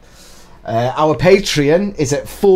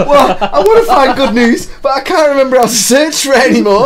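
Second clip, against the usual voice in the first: high, loud talking through laughter; helpless laughter; 12 semitones higher, 7 dB louder than usual. Real, not acted. Co-host failing to find good news, real helpless laughter. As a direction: squeal it out while cracking up.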